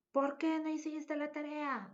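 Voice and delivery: high-pitched scolding question; mock scolding yell. Shown as a demonstration. Mother scolds child over homework, high mock yell. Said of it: "puede estar gritando".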